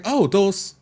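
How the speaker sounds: ordinary talk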